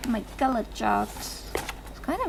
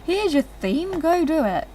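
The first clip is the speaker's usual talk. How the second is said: lightly voiced bored teacher, higher; dismissive teacher; 7 semitones higher than usual. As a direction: toss off the brief like an indifferent teacher. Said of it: "We were just given".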